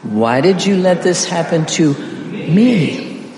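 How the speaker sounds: "me" held long with a high rise and fall; slow build that lands on a drawn-out "me"; stress on ME. Shown as a demonstration. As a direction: lean everything on the final "me". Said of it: "And the big one".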